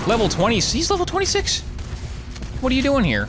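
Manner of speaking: high-pitched incredulous outburst; alarmed disbelief. Real, not acted. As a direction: voice leaps up in alarm. Alarmed by high-level enemy.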